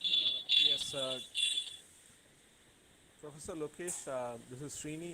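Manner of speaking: faint, far from the mic; almost too quiet to hear; barely audible. Real, not acted. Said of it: "Your voice is very low".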